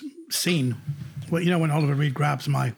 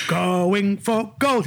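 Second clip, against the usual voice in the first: drawn-out title; 2 semitones higher, much slower than usual. Retold or imitated. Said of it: "I said".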